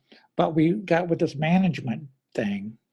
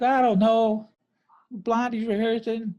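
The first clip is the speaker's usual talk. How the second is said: higher, put-on New Jersey accent; 8 semitones higher than usual. Drummer quoting Clem on the phone in a New Jersey accent. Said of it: "New Jersey accent".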